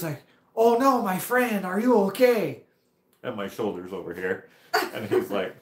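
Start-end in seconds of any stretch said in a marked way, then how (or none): 0.5-2.6 put-on loud, high, slow French-accented concern; hammed-up French accent, crying out in alarm. French rider checking on a fallen biker, imitated French accent. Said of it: "this French guy".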